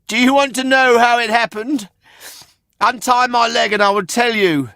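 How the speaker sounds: a bright wooden puppet teasing; puppet boy voice. A narrator's reading. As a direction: Pinocchio teasing the man who bought him. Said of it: "said Pinocchio".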